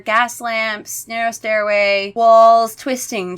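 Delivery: usual speaking voice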